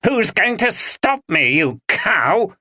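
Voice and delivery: high, sneering and spiteful; husband spitting back at his wife; spat insult. Acted. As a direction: spit the words at her. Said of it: "spat Dominic".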